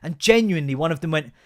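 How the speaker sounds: ordinary talk, nothing marked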